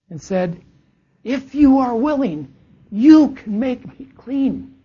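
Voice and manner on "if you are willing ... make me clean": high, loud, slow desperate plea; pleading leper. Acted. Leper kneeling before Jesus, begging to be healed. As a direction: beg for healing with everything you have.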